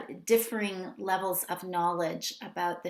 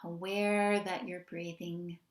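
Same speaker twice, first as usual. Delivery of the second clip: unhurried meditation guidance with long pauses; guided meditation. Real, not acted. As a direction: gently lead them into settling, phrase by phrase.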